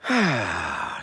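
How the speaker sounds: loud, falling "ahh" sigh; relieved sigh. Real, not acted. Streamer says that's better, loud relieved sigh.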